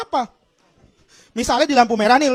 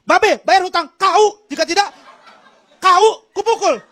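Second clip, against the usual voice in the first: put-on slow, loud, stiff Medan accent; hammed-up Medan tough guy; overdone Medan accent; 5 semitones higher, 5 dB louder, slower than usual. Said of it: "yang natural kayak orang ngobrol".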